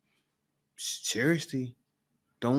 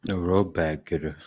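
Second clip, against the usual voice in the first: normal talking voice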